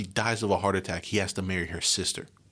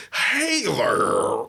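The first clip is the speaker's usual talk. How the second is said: mock hard-R American; 7 semitones higher, 4 dB louder than usual. Foreigners mocking how Americans say R, in a mock American accent. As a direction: lean on the R a little. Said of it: "they're always like".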